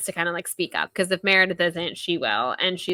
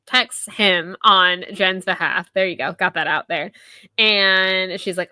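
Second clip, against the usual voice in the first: ordinary talk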